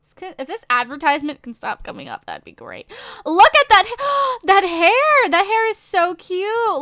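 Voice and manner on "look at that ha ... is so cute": high, drawn-out squeal of delight; gushing. Real, not acted. Streamer gushing over a game avatar's hair, excited. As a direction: gush louder and higher, gasping at the cuteness.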